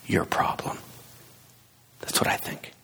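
whispered closing thought. Real, not acted. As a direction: drop to a whisper to land the point. Preacher's closing point.

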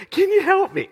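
tiny, very high imploring voice; mock pleading. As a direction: squeak out a hammed-up silent plea. Voicing kid's wordless pleading eyes.

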